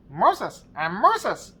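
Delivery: high, loud squawking parrot. Retold or imitated. Parrot answers the burglar, high loud squawk. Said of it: "And the parrot goes".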